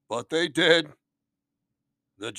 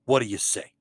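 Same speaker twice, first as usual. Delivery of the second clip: normal talking voice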